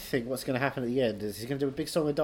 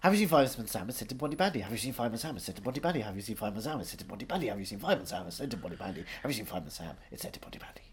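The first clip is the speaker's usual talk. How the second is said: fast Welsh accent, sinking lower and softer; rapid Welsh tongue-twister; 4 semitones lower, 5 dB quieter than usual. Shown as a demonstration. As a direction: rattle off Welsh repeats, fading as you go. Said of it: "Say it five times fast".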